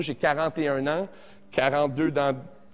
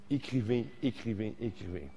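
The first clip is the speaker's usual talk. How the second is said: each écrivez softer and lower; fading away; 4 semitones lower, 8 dB quieter than usual. Shown as a demonstration. Teacher fading out his own command. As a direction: let the word trail off into a hush.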